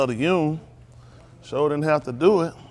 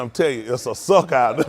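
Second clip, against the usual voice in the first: quoting the boot maker; 7 dB louder than usual. Retold or imitated. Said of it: "He said".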